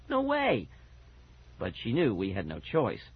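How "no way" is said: high wail of refusal. A narrator's reading. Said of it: "Fergie wailed".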